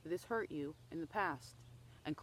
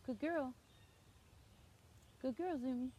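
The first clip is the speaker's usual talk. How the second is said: praising the dog; 6 semitones higher, 4 dB quieter than usual. Real, not acted.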